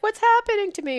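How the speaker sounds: high, fretful self-imitation; panicked past self. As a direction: squeak it out like you're losing it.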